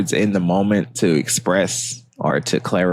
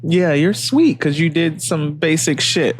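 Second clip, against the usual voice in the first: mocking gushing voice; 8 semitones higher than usual. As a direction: gush like you're over-impressed.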